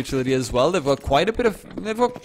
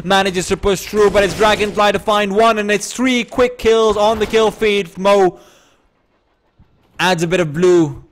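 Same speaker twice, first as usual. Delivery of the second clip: raised, louder call building on the kills; excited play-by-play; 6 semitones higher, 5 dB louder than usual. Real, not acted. Caster calls three quick kills to viewers, excited and louder. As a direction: lift pitch and volume as the kills land.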